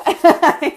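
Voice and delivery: a loud, high, excited laugh. Real, not acted. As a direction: laugh with real excitement at the crowd. Excited cheer to audience.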